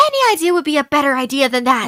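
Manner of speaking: fast, exasperated comeback; rapid-fire bickering retort. Acted. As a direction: shoot down the idea in one quick rush. Acted bickering over fixing painting.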